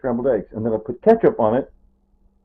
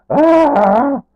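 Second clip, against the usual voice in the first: anguished cry; 14 semitones higher, 7 dB louder than usual. Real, not acted. Second wife died, outburst.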